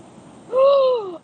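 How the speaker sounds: sharp high gasp from the cold; gasp at cold water. Real, not acted. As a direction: gasp as icy water hits.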